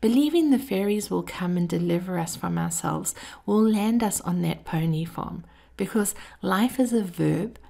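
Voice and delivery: ordinary talk, nothing marked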